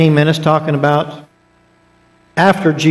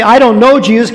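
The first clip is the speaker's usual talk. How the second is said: higher, louder retold denial; mock denial; 6 semitones higher, 5 dB louder than usual. Preacher quoting Peter's denial to the congregation, heated. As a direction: quote Peter with heat. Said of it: "He didn't just say".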